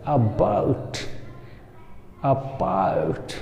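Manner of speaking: about softly, opening on a short 'uh'; initial schwa demo. Shown as a demonstration. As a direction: model about as 'uh-bout', spaced out. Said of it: "जैसे normal पढ़ते हैं अ". (about की शुरुआत छोटे अ से)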